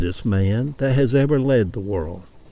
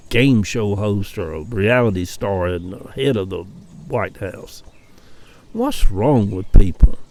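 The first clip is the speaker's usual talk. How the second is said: normal talking voice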